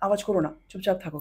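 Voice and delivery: low, softened temple reminder; imitated hushing. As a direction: hush someone gently, imitating a temple warning. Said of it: "মন্দিরে গিয়ে আমরা চুপচাপ". (চাপা গলায় চুপ করানোর নকল)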